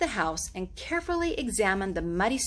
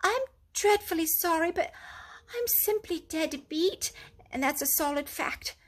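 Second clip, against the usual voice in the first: lightly higher worn-out mole voice; tired Mole; 7 semitones higher than usual. A narrator's reading. Exhausted Mole begs Rat to let him rest, weary. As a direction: plead to rest a little longer.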